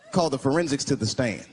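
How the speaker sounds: usual speaking voice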